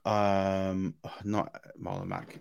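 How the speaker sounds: normal talking voice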